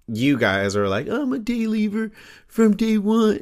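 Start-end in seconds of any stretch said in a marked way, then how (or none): 1.1-3.4 high fan voice. Pretend. Voicing a fan in high pitch.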